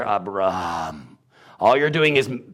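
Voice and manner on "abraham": ABRAM with a long drawn-out RAAHM; H put in. Shown as a demonstration. Demonstrating the added H, lengthened ending. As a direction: hold the last syllable of Abram long. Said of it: "If an H is put in there".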